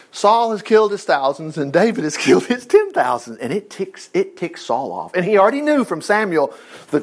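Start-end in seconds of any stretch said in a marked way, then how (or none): none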